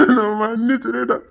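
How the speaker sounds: slow, sobbing plea; tearful apology. Acted. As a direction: drag the apology out through sobs. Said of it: "ஏன்டா அழுதுட்டு இருக்க".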